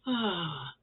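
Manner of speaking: ordinary delivery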